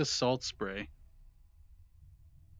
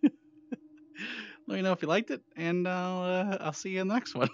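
ordinary talk, nothing marked

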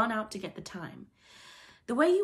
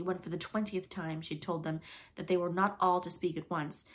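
plain reading voice

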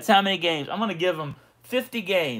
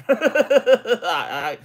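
laughing; 6 semitones higher, 6 dB louder than usual. Real, not acted. Laughs at calling himself homer.

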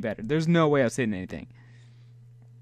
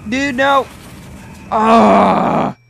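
much louder angry protests; shouting as tentacles grab the sub; frustrated yelling; 5 semitones higher, 11 dB louder, slower than usual. Real, not acted. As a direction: yell at the attacking monster. Attacked by tentacles.

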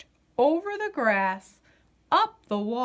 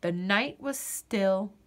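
plain reading voice

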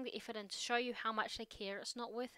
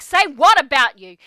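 indignant outburst; 4 semitones higher, 24 dB louder than usual. Retold or imitated. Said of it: "And I'm like".

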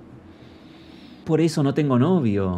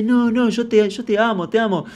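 much higher, pleading "yo te amo" in a put-on voice; mocking clingy girl; 9 semitones higher than usual. Vlogger mimics the girl pleading love, high and put-on. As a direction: squeak out the needy love claim. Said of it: "Y me dice".

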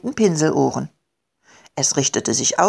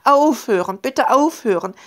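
high, wailing little creature's plea; begging; 6 semitones higher than usual. A narrator's reading. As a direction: plead pitifully, pitched up. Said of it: "bettelte nun seinerseits". (jammernd bettelnd, mit hoher Stimme)

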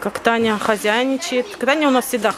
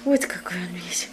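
whisper; 4 semitones lower, 8 dB quieter than usual. Real, not acted. Whispered repeat to cat.

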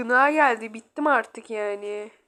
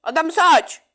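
higher, louder indignant question; exasperated retort; 5 semitones higher, 5 dB louder, faster than usual. Real, not acted. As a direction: throw it back, fed up: "O da mı suç?". (yüksek sesle sitemkâr soru)

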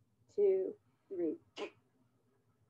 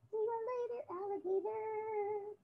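puppet goodbye; 8 semitones higher than usual. Acted. Puppet farewell rhyme.

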